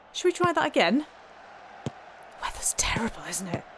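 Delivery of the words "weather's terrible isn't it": dry whispered aside. Real, not acted. Manager watching his side lose, wry whisper. Aside during bad match.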